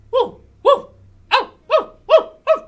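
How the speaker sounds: high, loud imitated barks; short excited woofs; dog barking. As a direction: bark back like an eager dog.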